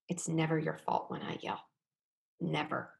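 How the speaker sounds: quiet insistence on "never"; gentle firm repeat of "Never."; stress on never. Shown as a demonstration. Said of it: "this part is key".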